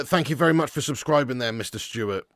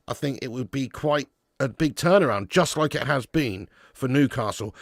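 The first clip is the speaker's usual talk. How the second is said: normal talking voice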